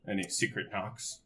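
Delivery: usual speaking voice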